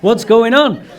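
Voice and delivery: loud, high, alarmed questioning; shocked outburst. Retold or imitated. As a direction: burst in demanding what's happening. Confronting her, shocked.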